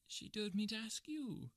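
very high, slow whine; drawn-out, plaintive whine; whining. Acted. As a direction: plead feebly in a high little-man voice. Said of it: "whined the little man".

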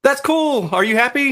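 put-on lightly high teasing girlfriend; a hint of an amused girlfriend. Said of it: "She's like".

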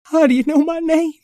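high, eager little puppy voice. Acted. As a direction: pitch way up like a small pup. Howdy Pooch and Patty Pan banter.

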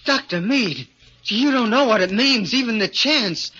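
high, rushing, eager small voice; eager little man. Acted. Little man begging the doctor to take him, high and eager. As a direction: grab the chance with both hands.

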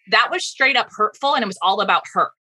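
ordinary talk

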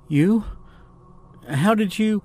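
high-pitched, startled questions; startled young woman. A narrator's reading. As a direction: jump in pitch, taken by surprise. Startled Jade finds someone behind her.

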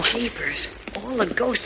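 hushed awe. Acted. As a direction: breathe it out in awe. Jimmy awed among statues.

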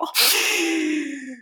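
a hammed-up sobbing gasp; dramatic gasp. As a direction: gasp as if heartbroken. Mock crying over a novel.

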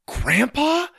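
high, loud, rising question; amazed. Acted. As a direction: blurt it out in astonishment. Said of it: "they said in amazement".

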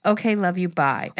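ordinary talk, nothing marked

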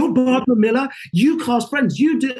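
fanboy meeting a legend; starstruck. Retold or imitated.

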